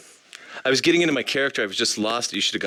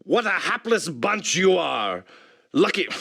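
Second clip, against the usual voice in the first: character read; 8 semitones higher than usual. Acted. Voice actor reading character line.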